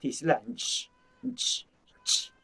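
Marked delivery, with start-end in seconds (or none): 1.3-2.3 a soft unvoiced "ch, ch" hiss; final ch sound. Shown as a demonstration. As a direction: breathe out "ch" with no voice. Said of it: "cái từ cuối này này". (âm "ch" bật hơi, không thanh)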